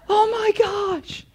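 overjoyed. Acted. Cheering a last-second win.

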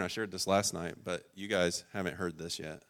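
normal talking voice

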